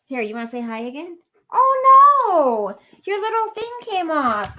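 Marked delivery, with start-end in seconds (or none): 1.5-4.6 much higher, louder startled cry; startled by the slipped shirt; surprised oh no. Real, not acted. Dog's shirt slips off on stream, high startled cry.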